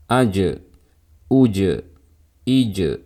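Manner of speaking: "Aje, uje, ije" all bouncing with one neutral release; uniform qalqalah. Shown as a demonstration. Teacher showing one sound after any vowel, uniform bounce in "aje, uje, ije". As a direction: keep the same short rebound after j in "aje", "uje", "ije". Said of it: "Jadi karakter suaranya satu".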